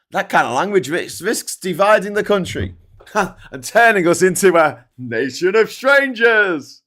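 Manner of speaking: a hint of a pompous Prime Minister, drawn out; mocking quote.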